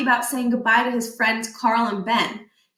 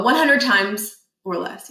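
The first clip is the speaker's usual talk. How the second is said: usual speaking voice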